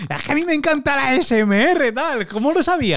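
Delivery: very high, excited mock fan squeal; squealing imitation of an excited guest; gushing fan impression. Mocking excited ASMR fans.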